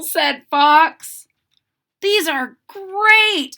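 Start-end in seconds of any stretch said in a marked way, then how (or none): 2.0-3.5 exclaiming. Acted. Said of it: "exclaimed Franklin".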